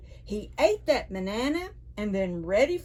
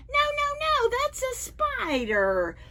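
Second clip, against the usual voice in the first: put-on much higher voice, a child monkey saying no; little monkey protesting. Storyteller to children, as the monkey, high protest.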